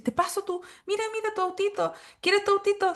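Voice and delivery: put-on high, sing-song coaxing to a small child; coaxing adult. Teacher coaxing an upset child with his toy car, high and sweet.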